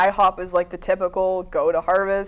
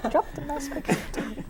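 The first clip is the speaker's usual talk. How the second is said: laughing through the words, higher; 5 semitones higher, 8 dB quieter than usual. Real, not acted. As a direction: giggle out the line. Repeating the line laughing.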